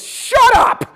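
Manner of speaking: high, loud shouted Shut up; mock yell. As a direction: bark it to cut him off. Instructor yells at him.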